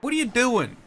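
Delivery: fed-up player yelling at a broken game; angry rant. Real, not acted. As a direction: rant at the game in real frustration. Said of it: "I'm getting mad at Planet Coaster now".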